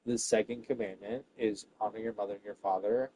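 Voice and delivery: ordinary talk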